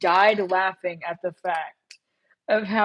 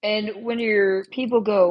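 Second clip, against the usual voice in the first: ordinary talk, nothing marked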